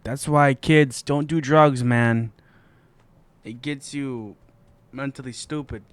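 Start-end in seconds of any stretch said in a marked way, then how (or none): 0.3-2.3 slow, loud PSA-style lecture; loud warning. Pretend. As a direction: belt it out like an anti-drug ad. Mock public service warning.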